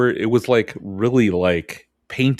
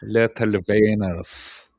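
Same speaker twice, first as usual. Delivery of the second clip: Little wieners again with a German v, slow; slow German-tinged Little wieners; mock German pronunciation; slower than usual. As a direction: savour Little wieners as "lettle venners". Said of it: "repeating the phrase".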